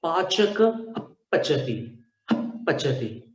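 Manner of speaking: पचती with lips pressed shut and air pushed out; teacher blows through closed lips on पचती; upadhmaniya demo. Shown as a demonstration. Teacher to students, demonstrating lip-blown पचती. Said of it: "अब उपध्मानीय का उच्चारण देखिएगा कैसा है".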